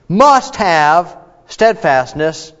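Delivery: "Must have steadfastness" drawn out slowly, each word weighted; slow emphatic reading. Shown as a demonstration. Teacher reading the scripture command slowly and emphatically. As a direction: read the command slowly and firmly. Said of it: "So it says".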